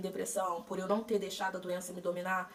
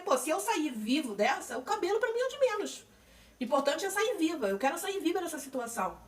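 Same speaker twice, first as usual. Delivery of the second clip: higher, resolved inner voice; high, firm promise to get through it alive; determined self-quote; 8 semitones higher, 3 dB louder than usual. Retold or imitated. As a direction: say it like a vow to survive. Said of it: "Porque eu falei".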